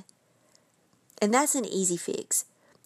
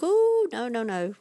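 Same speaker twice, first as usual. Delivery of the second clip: retold jolt of alarm, pitched way up; mock alarm; 3 semitones higher, 4 dB louder than usual. Retells spotting her overused word.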